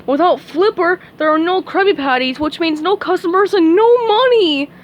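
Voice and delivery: loud blustering crab boss; gruff greedy captain rant; Mr Krabs voice. A narrator's reading. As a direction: panic about lost money. Mr Krabs panics.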